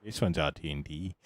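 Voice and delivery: normal talking voice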